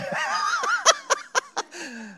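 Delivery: high squealing nervous laugh. Real, not acted. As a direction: burst into a shrill, nervous laugh. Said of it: "That's my nervous laugh".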